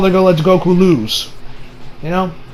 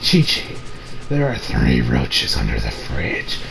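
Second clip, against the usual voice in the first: very low, slow, eerie voice; low creepy Goku; 9 dB quieter, slower than usual. Retold or imitated. Goku telling wife about roaches, low and eerie. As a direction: say it deep and slow, oddly detached. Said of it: "he says".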